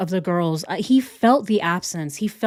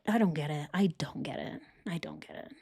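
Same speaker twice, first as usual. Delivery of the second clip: softer each time until only a breath; fading to a whisper; 3 semitones lower, 13 dB quieter, faster than usual. Real, not acted. Baffled by Chris, fading into a whisper. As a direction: let it die away into a whisper. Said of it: "repeating, fading out".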